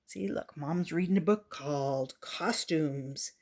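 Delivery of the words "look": ordinary delivery, nothing marked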